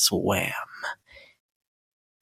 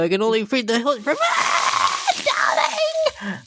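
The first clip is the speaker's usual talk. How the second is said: branding scream rising into a shriek of pain; 11 semitones higher than usual. Acted. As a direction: cry out as the hot iron bites. Branding iron burns her.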